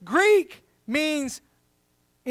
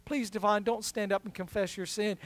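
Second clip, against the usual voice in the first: soft, anxious plea, barely voiced; hushed panicked pleading; pleading under breath; 4 semitones lower, 9 dB quieter than usual. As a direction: beg quietly so nobody hears. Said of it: "I'm like".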